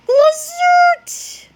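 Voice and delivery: high, loud electric zap; zap sound effect. A narrator's reading. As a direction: imitate a ray gun blast.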